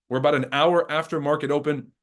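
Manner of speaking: ordinary delivery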